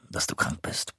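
a conspiratorial whisper; hushed, confiding aside. A narrator's reading. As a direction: drop to a secret whisper. Confidential aside about a person.

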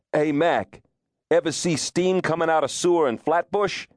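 hammed-up Brooklyn wise guy buttonholing a stranger; Brooklynite movie tough. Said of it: "it is a Brooklynite who carries the back lot".

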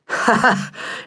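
unvoiced sly chuckle; breathy mocking laugh. Stranger cat laughs.

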